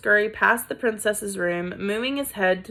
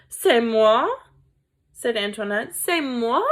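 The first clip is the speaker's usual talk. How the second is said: indignant French mother, loud; offended French "C'est moi?"; French accent; 4 dB louder than usual. Acted. Antoinette in French accent taking offense.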